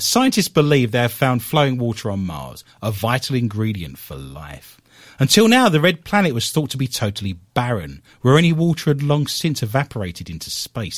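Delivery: news read. Acted. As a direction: read out the science bulletin. Reads a news item.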